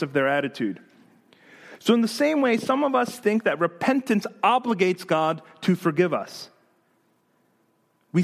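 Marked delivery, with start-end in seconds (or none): none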